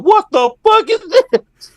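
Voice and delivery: high, slow shocked cry; shocked disbelief. Retold or imitated. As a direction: act out stunned disbelief. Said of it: "And they're like".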